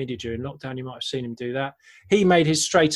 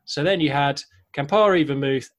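ordinary talk, nothing marked

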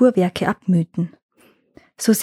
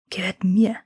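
hissed in a whisper; hissing. A narrator's reading. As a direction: hiss it, menacing and breathy. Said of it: "zischte er".

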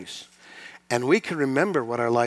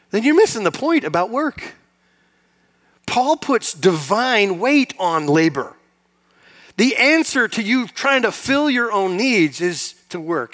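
louder and higher, slowed preaching emphasis; preaching at full force; 7 semitones higher, 7 dB louder than usual. Acted. Pastor urging the congregation to see work as holy, slow loud preaching. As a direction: lean hard on every word of the point.

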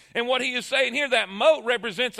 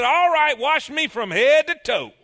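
louder, higher, all-in eagerness; eager Peter; 4 semitones higher, 6 dB louder than usual. Retold or imitated. Peter begging Jesus to wash him head to toe, eager. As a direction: throw yourself in, overdoing it. Peter swings to the other extreme.

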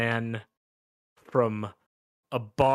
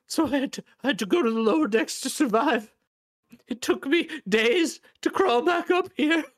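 very high, strained pleading; wretched, aggrieved little man; desperate crawling man; 12 semitones higher, 6 dB louder, faster than usual. Acted. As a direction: plead in halting bursts, voice pushed way up.

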